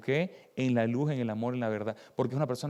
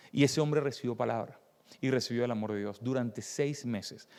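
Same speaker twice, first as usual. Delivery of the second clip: ordinary talk, nothing marked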